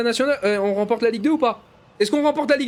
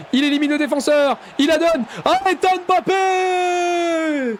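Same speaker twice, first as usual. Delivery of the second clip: fast, excited French match commentary; live play-by-play; 3 semitones higher than usual. Acted. Gamer calling his own match as commentator, excited play-by-play. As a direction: call the attack like a TV commentator.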